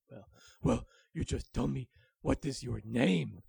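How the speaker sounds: loud, demanding wolf voice; gruff wolf. Acted. Willy demanding the dragon's name, loud and gruff. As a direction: press him for an answer.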